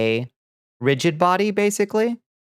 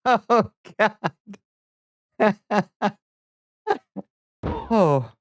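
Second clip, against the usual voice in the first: high laugh with a pained cry; laughing through pain; 9 semitones higher, slower than usual. Real, not acted. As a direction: laugh until it hurts. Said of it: "Oh, it hurts to laugh".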